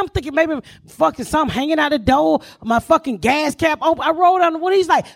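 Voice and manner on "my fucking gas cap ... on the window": usual speaking voice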